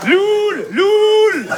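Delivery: put-on drawn-out North African-accented crowing; Hicham. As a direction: hoot it out with long vowels, comic Moroccan accent. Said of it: "l'accent arabe".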